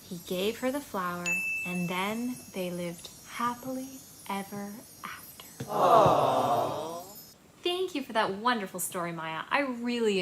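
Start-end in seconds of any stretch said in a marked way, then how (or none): 0.0-5.6 put-on reading to children. Reads a child's story aloud. 5.6-7.0 tender aww. Real, not acted. Reacts to story ending.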